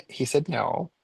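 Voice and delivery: usual speaking voice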